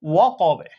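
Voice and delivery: ওয়া কবে with a bounced b echo at the end; qalqala stop. Shown as a demonstration. Teacher demonstrates qalqala on ওয়া কবে. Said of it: "কিভাবে পড়ব?".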